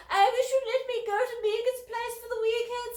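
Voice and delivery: put-on high, loud, sulky posh girl; pouting teen. A narrator's reading. Elder sister sulking to Dad about missing Megan's weekend, high and pouting. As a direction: whine it with a pout.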